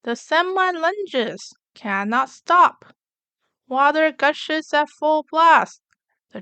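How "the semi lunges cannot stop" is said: ordinary delivery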